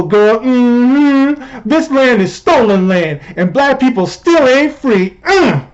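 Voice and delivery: high-pitched sassy caricature; exaggerated sassy tweet read-out; mock Cori Bush. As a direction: sass it up high, like an indignant girlfriend. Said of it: "Cori Bush tweeted out, and I quote".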